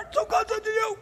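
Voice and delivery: high, soft strangled croak; hacking lost voice. Retold or imitated. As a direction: imitate preaching with no voice left. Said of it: "began to hack and swaller".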